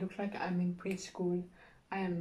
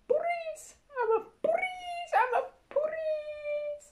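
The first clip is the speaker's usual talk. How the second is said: much higher squeal repeating police; squeaky mock cop; 4 dB louder than usual. Girl squealing she's the police, high. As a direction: squeak it like a whiny little kid.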